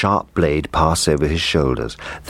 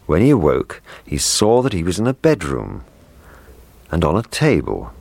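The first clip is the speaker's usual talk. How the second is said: usual speaking voice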